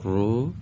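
«رو» with its vowel left open at the end; correct short damma. Shown as a demonstration. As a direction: model «رو» with a short u, not cut shut. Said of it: "نذارید آخرش به این شکل بشه". («رو» با ضمهٔ کوتاه و انتهای باز)

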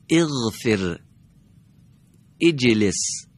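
each word set apart with clear pauses, every silent letter read with the vowel before it; word-by-word model reading for the class; deliberate demo reading. Shown as a demonstration. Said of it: "به کلمات دیگه دقت کنید".

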